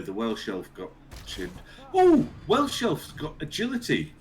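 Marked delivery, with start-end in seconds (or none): 1.9-4.1 excited. Real, not acted. Reacting to game surprise.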